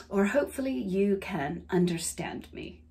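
carefully neutral, non-Southern accent. Shown as a demonstration. Mock-correcting herself from y'all, non-Southern accent. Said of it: "I've never actually talked like that".

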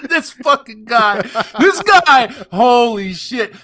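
high, loud cry through laughter; helpless, high-pitched laughing exclamation; laughing disbelief. Real, not acted. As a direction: crack up in disbelief, stretching the words. Cracking up at the guy.

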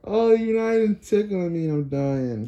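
high squealing laughter; laughing helplessly while tickled; being tickled. Real, not acted.

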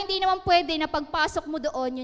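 ordinary talk

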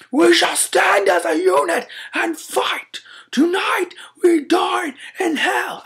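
high strained warrior shout; Spartan battle cry. Retold or imitated. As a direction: belt it like a movie Spartan. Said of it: "you're holding up a shield and you're like".

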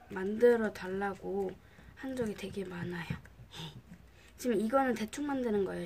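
normal talking voice

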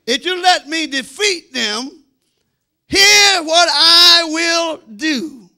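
ordinary talk, nothing marked

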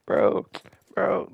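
high, loud imitation of a crybaby; mock whining. Streamer mimicking the players he trolls back, mock whining. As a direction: whine it loudly, mimicking sore losers.

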